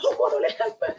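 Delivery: very high desperate plea; frantic cry. Acted. Mother pulling child out, frantic high cry. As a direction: beg for help in a frenzy.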